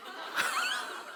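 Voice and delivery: high breathy laugh; squeaky laugh. Real, not acted. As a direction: burst into a high airy laugh.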